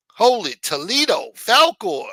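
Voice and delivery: high, loud delighted cry; excited. Real, not acted. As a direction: cheer a surprise donation. Excited at a donation.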